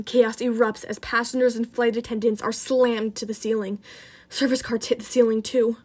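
ordinary delivery

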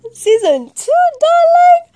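very high, loud squeal on darling; shrieking diva hype; squealed tease. Real, not acted. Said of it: "squealed repeat".

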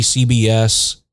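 ordinary talk

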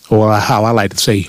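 normal talking voice

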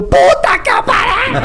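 high, loud, slow cry of shock; retold startled shout. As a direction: yell it out like you nearly fainted. His shout when father revived.

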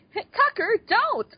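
high-pitched mock command; Sam. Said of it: "by saying".

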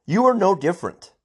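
high, loud angry outburst. Real, not acted. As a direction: shout it at the rioters, fed up. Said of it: "I'm angry".

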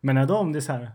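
ordinary talk, nothing marked

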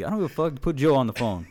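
mock angry demand. Caller insisting on talking to Joe, hammed-up loud demand. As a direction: bark the order half-joking.

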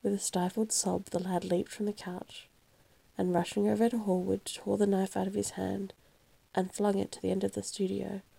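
ordinary delivery

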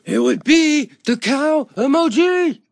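high, loud, slow triumphant yell; excited shout. Real, not acted. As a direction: belt out the answer. Answering the call-in question excitedly.

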